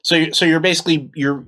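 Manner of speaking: normal talking voice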